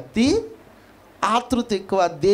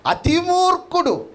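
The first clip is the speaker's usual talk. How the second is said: అతి మూర్ఖుడు raised high and slowed, weight on మూర్ఖుడు; emphatic high verdict; 12 semitones higher than usual. Acted. Preacher escalating the answer. (అతి మూర్ఖుడు ఎత్తైన స్వరంతో నొక్కి)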